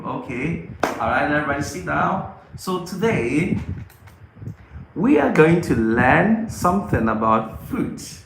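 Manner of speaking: usual speaking voice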